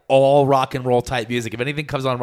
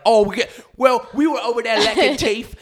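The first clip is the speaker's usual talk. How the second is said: much higher, louder put-on British lilt; mock British voice; 11 semitones higher, 4 dB louder than usual. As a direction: chirp it like a chatty local girl. Brit locals voiced.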